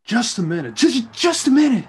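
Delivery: angry outburst. Acted. Mario jumping up angrily at Walton, with a touch of anger. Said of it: "Mario jumps angrily".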